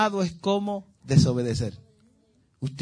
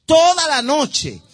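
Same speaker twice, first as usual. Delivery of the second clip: "Toda la noche" high, loud and slow; stress on toda la noche; 8 semitones higher, 7 dB louder, slower than usual. Real, not acted. Preacher answering his own question, stressing "Toda la noche". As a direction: stretch out "Toda la noche" with emphasis. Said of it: "¿Llorando cómo?".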